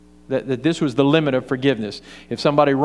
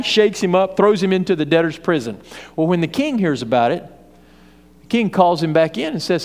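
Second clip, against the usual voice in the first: ordinary talk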